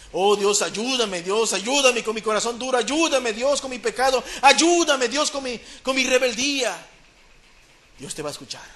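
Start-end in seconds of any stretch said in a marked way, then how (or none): 0.1-6.9 raised, insistent cry to God for help; desperate prayer. Acted. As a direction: plead harder with each "ayúdame", climbing in pitch. (súplica desesperada a Dios, con voz cada vez más alta)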